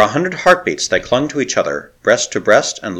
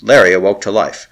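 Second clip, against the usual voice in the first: ordinary delivery, nothing marked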